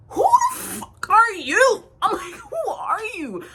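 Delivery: high, drawn-out outraged squawk; loud at first, then high and stretched; indignant challenge. Retold or imitated. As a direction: challenge strangers in high-pitched disbelief. Confronting strangers in retold quarrel.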